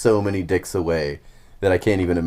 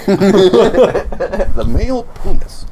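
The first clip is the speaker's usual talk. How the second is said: laughing; 13 semitones higher, 10 dB louder, much slower than usual. Real, not acted. Laughing, mock-solemn definition.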